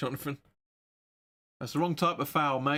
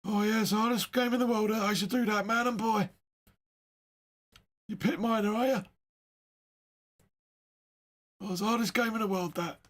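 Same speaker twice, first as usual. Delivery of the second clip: put-on high-pitched old man in the pub; Paul Whitehouse sketch; 6 semitones higher than usual. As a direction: do a hammed-up Cockney pub old-timer. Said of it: "that old man in the pub".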